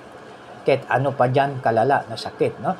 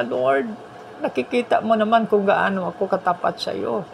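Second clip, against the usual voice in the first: higher pleading voice to the Lord; pleading prayer; 8 semitones higher than usual. Retold or imitated. King Hezekiah pleading with God in a higher voice. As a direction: beg God for more life.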